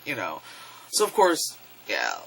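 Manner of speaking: ordinary talk, nothing marked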